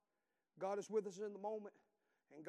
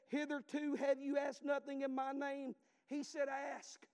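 loud, raised preaching voice; 5 semitones higher, 6 dB louder, slower than usual. Acted. Preacher booming scripture at the congregation, full force. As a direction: preach it out to the church.

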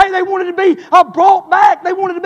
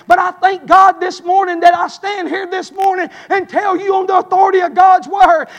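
ordinary talk, nothing marked

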